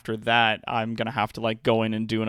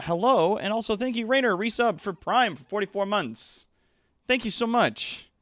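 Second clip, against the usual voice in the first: higher, bright thank-you to a subscriber; excited thanks; 10 semitones higher than usual. Real, not acted. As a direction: gush your gratitude. Thanking a resubscriber.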